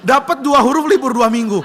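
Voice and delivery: loud high outraged shout; boy raging at the teacher; furious complaint. Acted. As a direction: protest in disbelief. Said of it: "Anak ini marah".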